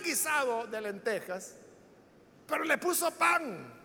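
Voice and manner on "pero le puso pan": ordinary talk, nothing marked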